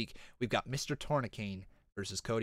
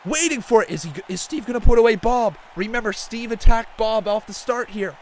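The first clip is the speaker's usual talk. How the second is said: much higher, louder, slow hype shout; wrestling commentator; 12 semitones higher, 14 dB louder than usual. Acted. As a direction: scream the big moment like a ringside announcer. Wrestling commentator calls moonsault.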